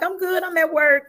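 answering a check-in text from her friend Karen; cheerful reply to friend. Retold or imitated. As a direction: brush it off brightly to a caring friend. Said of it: "I'm like".

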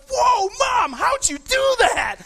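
high, loud, slow astonished yell; amazed shout. Retold or imitated. Boy shouting at mom after the fireball, amazed. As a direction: shout it like a kid who can't believe it. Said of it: "and I was like".

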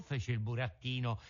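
usual speaking voice

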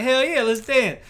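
high-pitched, keen yes; eager retold acceptance. Man saying yes to a dance at the bar, eager. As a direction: pitch it up like you're raring to dance.

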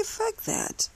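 defiant dismissal. Real, not acted. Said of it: "And I say".

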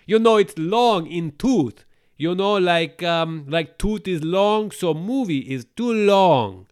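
high, loud, slow broken-English voice; hammed-up foreign-accent joke; exaggerated pidgin explainer; mock broken English.

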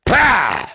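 loud, high burst of "pow"; explosion noise. Retold or imitated. Storyteller imitating a bottle rocket going off, explosive shout. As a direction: punch it out like a blast.

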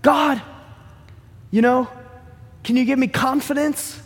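mock needy prayer; pleading prayer. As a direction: beg God for confidence. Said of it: "And now you're like".

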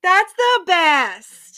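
loud, high, drawn-out exclamation; high, stretched cry of delight; gleeful shout. Real, not acted. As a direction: belt it out slowly with delight. Gleeful reaction to favourite scene.

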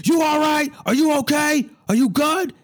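worried coworkers calling out high and loud; concerned coworkers. Retold or imitated. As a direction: coworkers asking the driver if he's okay. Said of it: "they're like".

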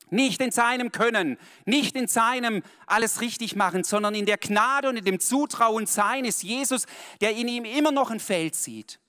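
fervent slowed sermon emphasis; emphatic preaching. Real, not acted. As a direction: drive the point home. Preaching at full force.

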